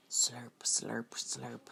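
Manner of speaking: hushed, breathy slurp sounds; spooky whispered sound effect. A narrator's reading. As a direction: whisper the slurps like a secret noise. Sound words in story.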